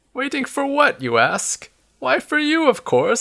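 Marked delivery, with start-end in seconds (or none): 0.1-1.0 very high, curious query; pitched-up wondering voice; puzzled question. Acted. Listener questions the old man. 2.0-3.2 put-on high-pitched, delighted old-timer; lilting, pitched-up old man. Old man answers the obvious question.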